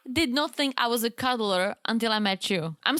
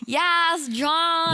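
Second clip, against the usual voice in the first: higher, drawn-out fan cheer; 5 semitones higher than usual. Acted. Host cheering on partner as a fan, enthusiastic. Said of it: "like, a fan of you".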